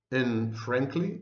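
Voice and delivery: usual speaking voice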